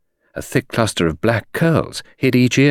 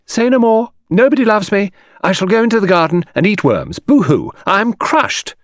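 mock despair; 10 semitones higher than usual. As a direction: ham up a tragic sulk with a fake boo-hoo. Mock tragic lament, fake sobbing.

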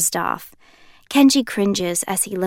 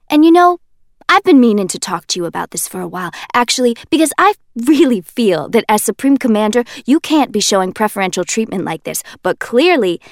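ordinary delivery

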